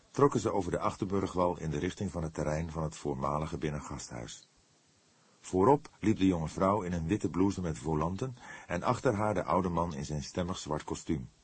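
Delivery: plain reading voice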